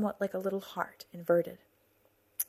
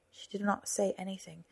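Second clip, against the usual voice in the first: ordinary delivery, nothing marked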